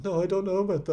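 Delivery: acted line. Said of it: "I have a few lines with him".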